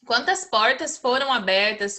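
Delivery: usual speaking voice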